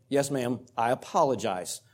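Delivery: soft, polite and kind apology; kindly. Retold or imitated. Coach answering the angry official, soft and kind. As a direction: apologize gently, keeping it soft. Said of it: "very kindly I said".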